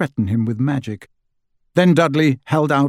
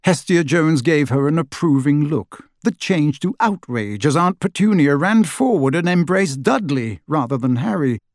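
usual speaking voice

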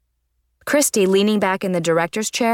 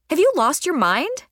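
high-pitched, incredulous reproof; firm; 8 semitones higher than usual. A narrator's reading. Club leader confronting her friend, firm incredulous question. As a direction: demand it firmly, pitch swooping up. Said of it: "said Christy firmly".

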